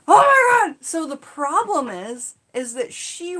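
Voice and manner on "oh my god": high, loud alarmed cry; panicked shout. Retold or imitated. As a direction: shout it like you just saw her sink. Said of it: "And I'm like".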